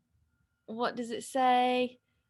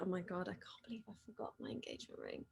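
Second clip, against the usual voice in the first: a fast, much softer muttered aside; hushed aside; 4 semitones lower, 15 dB quieter, much faster than usual. Real, not acted. Host muttering to herself about the forgotten ring, fast hushed aside. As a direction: say it under your breath, quickly.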